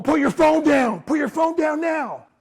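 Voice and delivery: loud, high, slow barked order; shouted command. Retold or imitated. Gunman shouting at the salesman to drop his phone.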